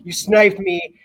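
playfully aggrieved complaint; hammed-up sore loser; mock upset. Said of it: "he instantly gets mad".

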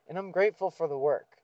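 ordinary talk, nothing marked

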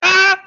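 sudden high yelp of fright; a startled old lady's cry; startled shriek. Acted. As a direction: jump out of your skin. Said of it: "she just goes".

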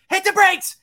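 very high, loud panicked-style command; imitated screaming. Driving instructor ordering student to brake, screaming. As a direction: yell it as the overbearing instructor. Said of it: "he was, like, screaming at you".

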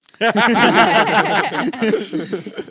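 high, loud burst of laughter. Real, not acted.

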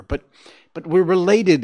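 high, loud plaintive appeal; anguished, stammered protest; lamenting. A narrator's reading. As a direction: plead in rising distress. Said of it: "he lamented".

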